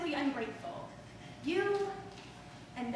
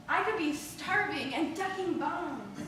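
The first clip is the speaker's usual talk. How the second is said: ordinary talk, nothing marked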